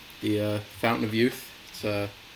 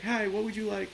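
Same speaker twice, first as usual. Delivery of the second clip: higher, lightly sing-song order taker; drive-thru worker; 9 semitones higher, 4 dB quieter than usual. Retold or imitated. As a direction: put on a high customer-service voice. Drive-thru worker voiced in retelling.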